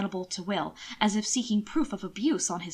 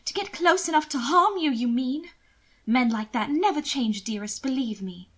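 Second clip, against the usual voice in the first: higher, slowed teasing reproach; higher society lady voice; lady's mock scolding; 4 semitones higher than usual. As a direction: chide him with affected sweetness. Mina warning Will.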